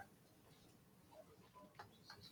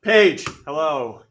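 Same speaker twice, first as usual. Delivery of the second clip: sarcastic hello; 4 semitones higher, 9 dB louder, much slower than usual. Real, not acted. Teacher calls on a student.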